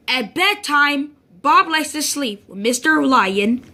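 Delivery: ordinary delivery, nothing marked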